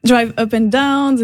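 ordinary talk

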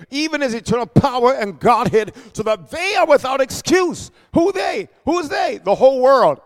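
rising into a preached shout on the scripture; preaching build. Acted. Pastor to congregation, preaching build. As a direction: lift the verse to the congregation.

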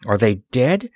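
ordinary delivery, nothing marked

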